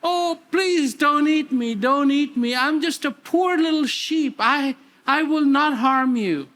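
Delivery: a scared little cub pleading for its life; frightened pleading cub. Acted. Lion cub begging the big lion, frightened plea. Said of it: "He goes".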